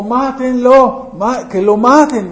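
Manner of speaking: a touch of a raging mob; crowd shouting. Retold or imitated. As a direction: voice the mob calling for his death. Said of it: "estaban empezando a gritar".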